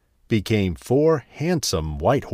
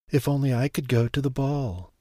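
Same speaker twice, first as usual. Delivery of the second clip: soft, higher, tearful longing; tearful wish; 4 semitones higher, 4 dB quieter than usual. Acted. Cinderella crying by the fire, wishing for the ball, tearful. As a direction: Cinderella alone by the fireplace, softly tearful. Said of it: "she said through her tears".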